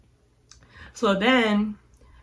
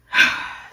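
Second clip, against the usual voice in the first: loud, short huff of breath; fed-up exhale; exasperated sigh; 4 dB louder than usual. Retold or imitated. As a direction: sigh out your irritation. Fed up while telling of waiting.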